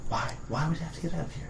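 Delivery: soft, fast and hushed; stage whisper. Acted. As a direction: hurry it out under the breath. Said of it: "stage whisper then".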